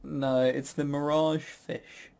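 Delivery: normal talking voice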